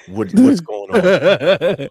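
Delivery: laughing disbelief. Retold or imitated. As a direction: read the text out cracking up. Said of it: "I got a text like".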